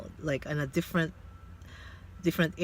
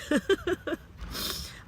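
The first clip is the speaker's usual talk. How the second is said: a high breathy laugh through tears; tearful laugh. Real, not acted. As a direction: laugh it off while fighting back tears. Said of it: "I am crying now".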